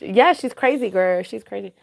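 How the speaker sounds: ordinary talk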